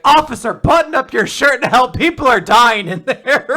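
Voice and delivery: loud, high exasperated yell; urgent, hammed-up shouting; outraged paramedic. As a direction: shout it like an appalled paramedic at an officer. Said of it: "a paramedic's like".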